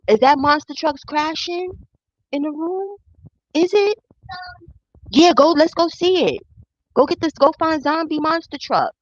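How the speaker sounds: high, animated talk to a little one. Real, not acted. Breaks off to humor small child.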